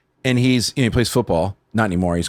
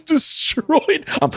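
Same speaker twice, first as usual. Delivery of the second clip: ordinary talk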